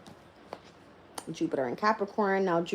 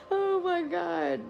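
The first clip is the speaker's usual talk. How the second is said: slow, high, tearful laugh; crying with laughter; 10 semitones higher, much slower than usual. Real, not acted. To viewers over a client's wish, crying with laughter. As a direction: laugh until it almost turns to crying. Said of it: "I'm cracking up".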